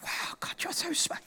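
awed hushed whisper. Acted. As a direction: breathe it in awe. Awed aside to God.

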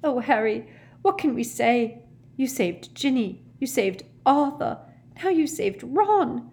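high, overcome mother's voice; tearful, sobbing. A narrator's reading. Mother thanking Harry for saving her family, acted tearful. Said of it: "she sobbed".

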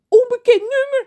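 very high, loud panicky cry. Acted. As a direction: panic at the ringing phone. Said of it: "zegt John paniekerig".

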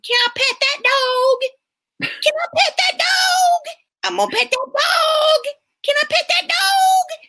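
put-on very high, drawn-out little kid voice; squeaky-high kid whine, stretching the words; sick kid imitation. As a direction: mimic a child pleading at the top of their register. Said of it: "sick kid who's supposed to be going to sleep".

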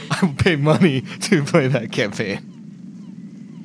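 high, slow words through laughter; real laughing. Real, not acted. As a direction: barely get it out while cracking up. Laughing at the character voice idea.

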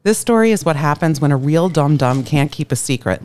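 normal talking voice

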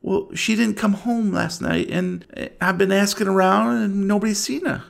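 a hint of anxious small-town woman; worried mother. Game master voices worried mother.

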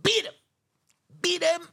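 loud, high-pitched shouted bursts; violent shout. Retold or imitated. Man retelling a beating with his stick, violent shout. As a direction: shout each blow out hard.

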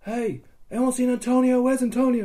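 high, projected searching call; retold yelling. Player searches for Antonio, retold high yell. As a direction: call out across a crowd. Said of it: "I'm, like, yelling".

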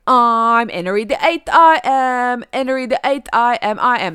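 Cockney music-hall chant, "Henry" as "Enery"; dropped H's. Shown as a demonstration. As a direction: belt it slowly in mock Cockney, no H's. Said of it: "There's no H's".

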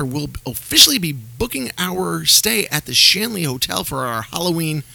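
ordinary delivery

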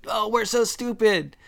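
high-pitched self-mocking wail; mock dismay. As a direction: whine it in exaggerated self-reproach. Acting out their own embarrassed realization.

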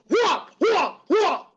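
high, loud whooping sound effects; imitated beating noises. Friend mimics pistol-whip blows with high whoops.

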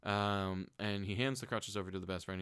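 ordinary talk, nothing marked

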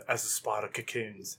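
attempted British accent, slow and low-key; slow, understated British nature narrator. Friend told to do a British accent, British-accented try. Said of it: "Do a British accent".

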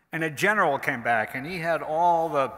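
ordinary delivery

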